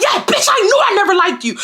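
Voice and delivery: high-pitched, heated mock yell; retold yelling. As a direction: hurl it out high, as an insult. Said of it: "I'm yelling at her".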